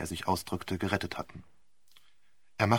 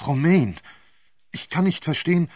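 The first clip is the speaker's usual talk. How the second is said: higher, louder, pleading and lost; distraught young man; 7 semitones higher, 7 dB louder than usual. Acted. Vole pleads with Romain in disbelief, higher and louder. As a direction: plead with Romain, not understanding.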